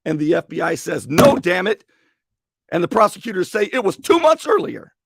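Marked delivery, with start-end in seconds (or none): 1.1-1.8 sharp loud no, damn it; angry retort. Retold or imitated. FBI agent scolding a witness, loud and annoyed. FBI rejecting her statement. 3.7-4.9 much higher and louder, pushing the date; insistent prosecutor. Retold or imitated. Prosecutors insisting to the mayor, loud and high.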